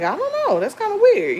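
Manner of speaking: a high, baffled shrug of a reply; puzzled. Retold or imitated. Answering Austin about the beeping at night, high puzzled. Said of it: "So I'm like".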